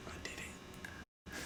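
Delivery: slow, hushed confession; furtive whisper. Pretend. Admitting it on the podcast, whispered. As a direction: whisper it like a secret.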